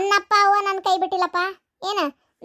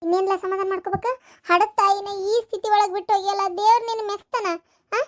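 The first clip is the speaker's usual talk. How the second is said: ordinary talk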